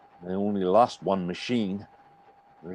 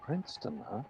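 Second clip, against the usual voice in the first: whisper; 8 semitones higher, 12 dB quieter than usual. Acted. Stranger repeats place warily.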